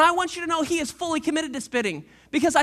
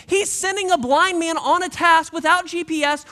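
ordinary talk

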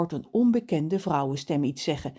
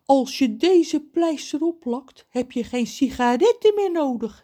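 high character voice, slowing for mystery; mysterious; 9 semitones higher, 6 dB louder than usual. A narrator's reading. John presents the plaster to the crow, mysterious. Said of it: "zegt hij geheimzinnig".

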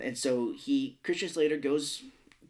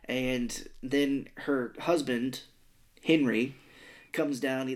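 usual speaking voice

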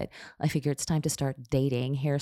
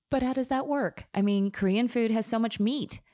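puzzled; 8 semitones higher than usual. A narrator's reading. Stylist puzzled by vegan Korean food.

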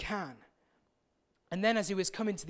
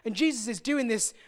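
usual speaking voice

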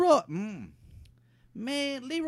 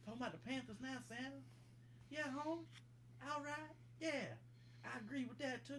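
much softer, murmured into a pretend phone; mumbled phone call; 22 dB quieter than usual. [name] murmuring to Santa on his pretend phone, much softer. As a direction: mutter into the hand like it's a phone call.

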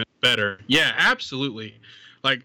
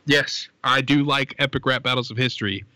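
ordinary talk

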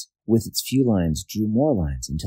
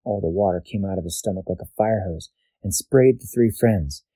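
ordinary delivery, nothing marked

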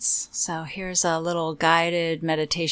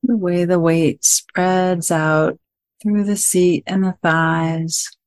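guided meditation; 7 dB louder than usual. Acted. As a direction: guide listeners gently into stillness, phrase by phrase.

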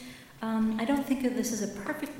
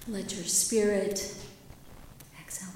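slow, soft breathed prayer; 6 dB quieter than usual. Shown as a demonstration. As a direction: breathe the words out slowly. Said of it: "we begin by our inhale saying".

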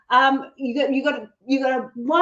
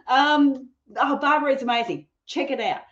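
ordinary talk

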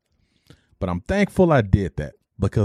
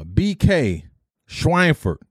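roll call; 4 semitones higher, much slower than usual. Retold or imitated. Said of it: "they're calling people".

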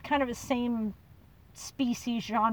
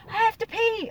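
fake innocent excuse; 11 semitones higher, 3 dB louder than usual. Her dodge answer to mom.

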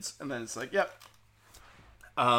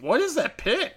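mock surprised; 13 semitones higher than usual. Wanda dying, notices the pin.

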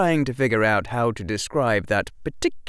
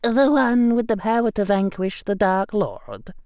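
high Scottish professor with a touch of irony; strange irony; 14 semitones higher, 4 dB louder than usual. A narrator's reading. Professor quoting the boy's legend, faintly ironic. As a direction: recite the title with mild irony. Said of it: "a strange irony leavening her voice".

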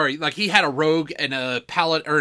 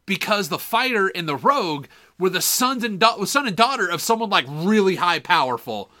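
usual speaking voice

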